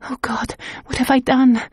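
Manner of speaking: whisper-near self reproach; despairing. Acted. Governess fleeing in horror, despairing.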